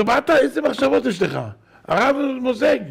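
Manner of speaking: loud, high-pitched scolding shout; צעקת תוכחה. Retold or imitated. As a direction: scold indignantly at the top of the voice. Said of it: "הוא צועק ... בקול רם". (נזיפה בקול רם)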